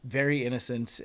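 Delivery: ordinary delivery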